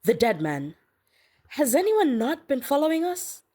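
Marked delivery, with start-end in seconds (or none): 1.5-3.4 high-pitched, incredulous outburst; acted exasperated question. A narrator's reading. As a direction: ask it in high-pitched disbelief. Exasperated outburst in chase scene.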